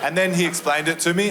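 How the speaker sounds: normal talking voice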